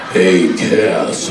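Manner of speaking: deep gruff imitation of Jensen; low tough voice. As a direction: drop low to sound tough. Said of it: "So he's like".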